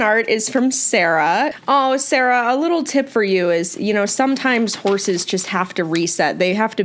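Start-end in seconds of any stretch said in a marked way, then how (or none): none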